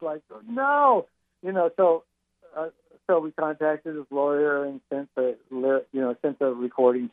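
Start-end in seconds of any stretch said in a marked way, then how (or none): 0.5-1.1 high-pitched retold "oh no" panic; panicked cry. Bandmate recalling everyone's reaction to the lyrics question, mock panic. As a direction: imitate a band's collective panic, pitched way up. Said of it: "we all panicked".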